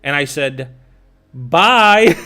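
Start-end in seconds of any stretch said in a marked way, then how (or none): 1.3-2.2 loud, higher "Bye"; mock send-off. Answering her wish to leave, loud mock send-off. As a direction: call out a dismissive goodbye. Retells his curt send-off.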